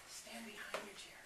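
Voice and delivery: soft whispered instruction; whispering. Real, not acted. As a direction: whisper the direction. Directing children quietly.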